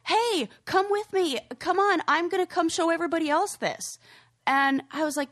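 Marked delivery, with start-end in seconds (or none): 0.1-4.0 high, excited invitation; rally a friend to come along; eager friend. Acted. Adult urging a friend to share good news, eager and high. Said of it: "it should be like".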